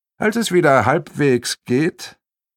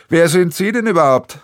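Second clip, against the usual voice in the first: loud, higher demand; interrupting; 6 semitones higher, 5 dB louder than usual. Acted. Arno cuts into the quarrel, loud demand. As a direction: cut in loudly.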